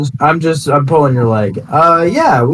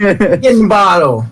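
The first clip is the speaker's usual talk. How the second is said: put-on higher-pitched copy of Elga's accent; playful put-on accent, raised pitch; absorbed character accent; 6 semitones higher than usual. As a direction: echo the order in her borrowed accent. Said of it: "I've absorbed her accent".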